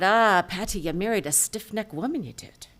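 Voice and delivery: loud, knowing Irish barkeep; Irish brogue, chiding; Irish-accented bartender teasing. Acted. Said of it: "And the barkeep said".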